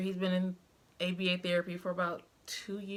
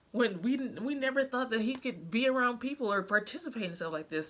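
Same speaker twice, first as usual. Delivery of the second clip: retold tearful plea; 2 semitones higher than usual. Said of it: "bawled like a baby in her office and was just like".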